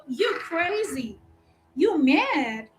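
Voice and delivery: slow, soft sassy drawl. Shown as a demonstration. Teacher demonstrating the right tune to students, slow and sassy. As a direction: lay it back like Madea. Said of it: "You're gonna go".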